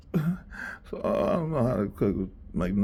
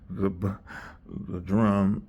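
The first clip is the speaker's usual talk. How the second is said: normal talking voice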